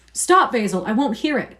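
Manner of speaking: loud protest, refusing to listen; cried out. A narrator's reading. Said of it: "cried Dorian".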